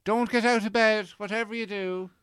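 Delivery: high, sing-song passive-aggressive; passive-aggressive mum. Retold or imitated. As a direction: sweetly needle the hungover son. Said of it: "say something passive-aggressively".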